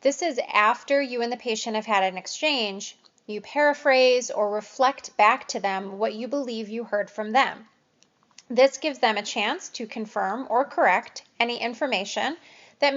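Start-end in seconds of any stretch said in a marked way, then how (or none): none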